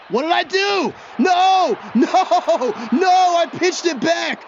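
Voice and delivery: high, loud panicked yelling; real shock at his own blunder, shouting; horrified disbelief. Real, not acted. Gamer yelling at himself after pitching the ball back, horrified. Accidental pitch ruins the play.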